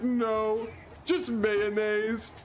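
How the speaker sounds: put-on child correcting mom that he wants only mayonnaise; insistent little kid. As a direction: insist like a stubborn six-year-old.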